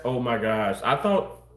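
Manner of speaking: usual speaking voice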